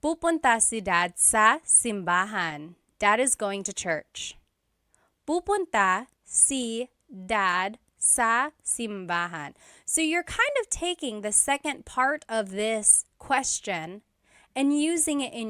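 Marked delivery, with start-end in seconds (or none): 5.2-9.5 slowed right down, each word spelled out for learners; slow and clear. Shown as a demonstration. Teacher repeats the Tagalog answer for students, slow and clear. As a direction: give the learner every syllable of Pupunta si dad sa simbahan slowly. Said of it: "And then the response".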